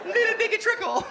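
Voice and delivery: put-on very high baby-talk coo; squeaky doting parent with a laugh. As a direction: coo at the newborn. Parent cooing over baby.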